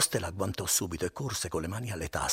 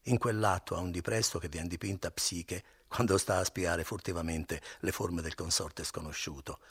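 ordinary delivery

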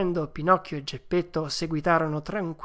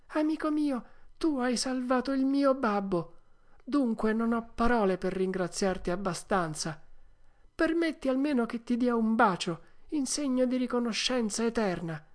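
higher, moved puppet voice; commosso; 8 semitones higher than usual. A narrator's reading. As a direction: thank a rescuer with heartfelt emotion. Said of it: "con voce commossa gli disse". (voce acuta e commossa, piena di gratitudine)

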